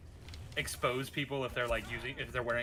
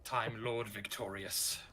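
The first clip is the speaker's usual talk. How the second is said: ominous Time Lord line; 4 dB quieter, slower than usual. Acted. Answers with another Doctor line.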